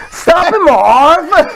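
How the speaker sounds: high, slow laughing gangster yell; Joe Pesci. Retold or imitated. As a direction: belt it out like a Home Alone burglar through laughter. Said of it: "Joe Pesci in Home Alone".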